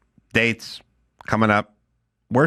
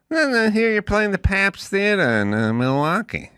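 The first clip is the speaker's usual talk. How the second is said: high-pitched talk-show host impression; phone chatter in a high comic voice; Jay Leno; 9 semitones higher than usual. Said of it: "Leno called me over the weekend".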